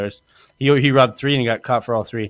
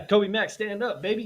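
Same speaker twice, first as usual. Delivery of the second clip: hype announcer; 9 semitones higher, 5 dB quieter than usual. Acted. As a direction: bring him on like a ring announcer. Hyping guest like an announcer.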